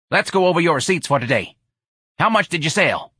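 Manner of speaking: mildly irritated employer voice; exasperated boss. Acted. Exasperated boss demands the receipts.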